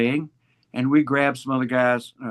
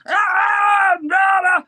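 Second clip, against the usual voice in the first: high, loud mock shouts of a wild crowd; excited crowd; 6 dB louder, much slower than usual. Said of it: "They were".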